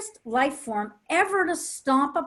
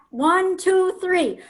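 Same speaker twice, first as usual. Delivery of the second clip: excited; 5 semitones higher, 7 dB louder, slower than usual. Real, not acted. Excited countdown before roaring.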